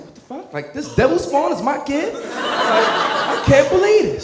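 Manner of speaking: high-pitched disbelief; acted shock climbing into a drawn-out cry; incredulous. Father shocked by daughter's Facebook, high incredulous voice.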